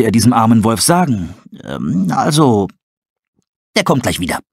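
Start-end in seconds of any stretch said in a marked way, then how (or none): 1.6-4.4 hesitant owl. Acted. Tully fibbing to the poor wolf, hesitant.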